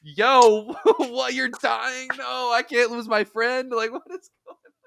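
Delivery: high, panicked laughing disbelief; mock panic. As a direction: act shocked that your friend is dying. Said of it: "And I'm just like".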